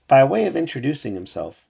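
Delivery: ordinary delivery, nothing marked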